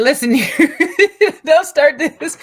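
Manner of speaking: loud, high mock scolding; laughing through a stern warning; aunt telling off her little nephew, loud and high.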